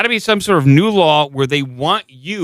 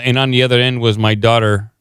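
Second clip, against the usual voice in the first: ordinary talk, nothing marked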